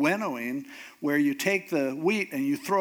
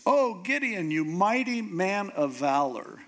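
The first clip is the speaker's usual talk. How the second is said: mock-grand, high-pitched salute; drawn-out, hammed-up flattery; tongue in cheek; 4 semitones higher than usual. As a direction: hail an unlikely hero with playful irony, starting high. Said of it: "had his tongue firmly planted in his cheek".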